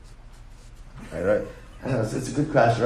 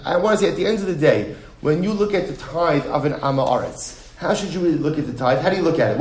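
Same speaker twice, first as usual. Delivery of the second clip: ordinary talk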